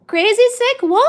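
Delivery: loud, high and excited; excited greeting. Real, not acted. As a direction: greet them with a burst of excitement.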